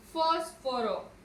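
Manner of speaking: «Fósforo» lento, FÓS sostenida y separada de «foro»; acento en FÓS. Shown as a demonstration. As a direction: marca la primera sílaba de «Fósforo». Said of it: "Su mayor fuerza de voz recae en". («Fósforo» partido tras FÓS)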